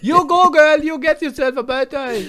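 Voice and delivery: loud, high East German character; Ossi accent. Acted. Gossips recognising a celebrity, loud high Ossi accent. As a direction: get carried away in an East German accent. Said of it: "du gehst da richtig drin auf".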